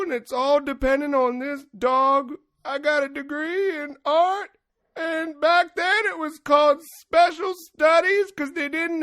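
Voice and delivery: put-on high, slow teary old lady voice; imitating the sobbing lady. Old lady telling her story, mock teary high voice. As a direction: let the old lady's words wobble out through tears. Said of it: "she's like".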